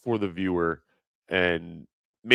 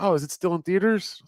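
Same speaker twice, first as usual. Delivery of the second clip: lightly voiced higher cashier; cashier's question; 12 semitones higher than usual. Cashier asking if the film still plays, mildly high. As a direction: innocent puzzled question, pitched up. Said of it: "She's like".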